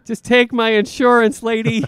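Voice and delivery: hammed-up exasperation; mock exasperated plea. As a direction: plead in fake frustration.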